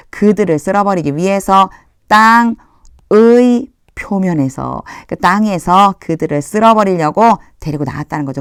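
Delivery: ordinary talk, nothing marked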